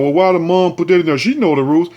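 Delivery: lightly voiced scolding crowd; mocking the public. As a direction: sneer it like a know-it-all onlooker. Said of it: "Everybody talking about".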